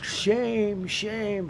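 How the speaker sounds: high, mock chant of shame; imitating the screaming protester, pitched high; mock protester yell. Imitating the protester screaming at police, high mock chant.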